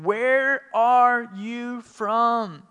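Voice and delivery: high, loud and very slow, full of dread; frightened question. A narrator's reading. Frightened Pilate asks the silent Jesus, slow and high. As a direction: ask it as a man scared of the answer. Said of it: "he was more frightened than ever".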